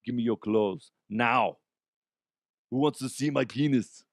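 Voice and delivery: hint of a Terminator bark; Arnold impression. Shown as a demonstration. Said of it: "that also happens with Arnold".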